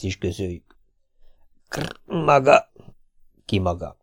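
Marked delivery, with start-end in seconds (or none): 1.7-4.0 croaking mayor. A narrator's reading. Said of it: "influenzás varjuhörrentés".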